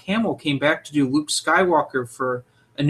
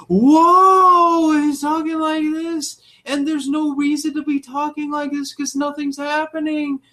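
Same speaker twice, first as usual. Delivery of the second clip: much higher, louder, breathless melodramatic narrator; overdramatic narrator; 13 semitones higher, 4 dB louder than usual. Retold or imitated. Mocking an audiobook narrator to a friend, way over the top. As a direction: overact it like a hammy audiobook reader. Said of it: "then suddenly".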